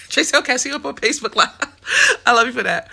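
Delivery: loud, fast, laughing through it. Real, not acted. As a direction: burst out laughing as you speak. Laughing while signing off.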